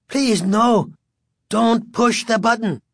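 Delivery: put-on high, pleading old man's cry. A narrator's reading. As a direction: cry out in desperate protest. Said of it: "the old man cried".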